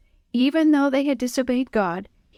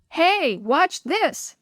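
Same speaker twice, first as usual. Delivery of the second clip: slow high showing-off shout; 7 semitones higher, much slower than usual. A narrator's reading. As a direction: yell to get attention. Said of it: "someone shouted behind him".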